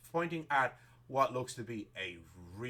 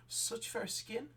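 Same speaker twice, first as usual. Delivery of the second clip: higher, slow, cooing over fair skin; admiring seamstress; 11 semitones higher, 4 dB quieter, slower than usual. Acted. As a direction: savour the compliment, drawn out.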